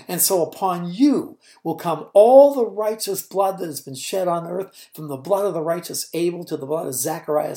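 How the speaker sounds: Jesus warning the leaders of what they'll do; Jesus denouncing. Acted. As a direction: build to a loud accusation.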